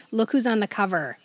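usual speaking voice

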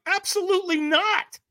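high, squeaky flat-out no; emphatic refusal. Retold or imitated. Refusing a stranger's invitation to firewalk. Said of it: "And I'm like".

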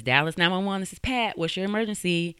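high, lilting call-taker voice; dispatcher's greeting. Retold or imitated. Nine one one operator answering a call, routine lilt.

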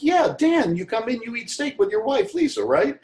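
bright and quick, proving you remember him; eager recall. Retold or imitated. Waiter reminding Dan of his steak dinners, high eager. Eagerly listing the regular's habits.

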